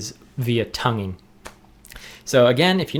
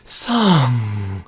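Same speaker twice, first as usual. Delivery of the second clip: breathy, louder "song" with air escaping; 5 dB louder than usual. Shown as a demonstration. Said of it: "say the word song in that extremely weird and awkward breathy voice".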